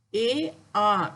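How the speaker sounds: drilling the Tamil letter sound "A" twice. Shown as a demonstration. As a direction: repeat "A" so the pupils can copy it. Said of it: "Bunyinya A".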